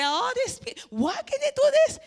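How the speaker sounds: high, loud exasperated cry; mother fuming at the mess; exasperated outburst. Retold or imitated. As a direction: blow up at the mess in the driveway.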